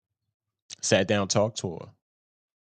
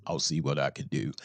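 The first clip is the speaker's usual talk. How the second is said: a lower, quieter put-down of a hollow phrase; dismissive mumble of a stock excuse; mocking empty promise; 8 semitones lower, 8 dB quieter, faster than usual. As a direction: drop low and soft, brushing it off.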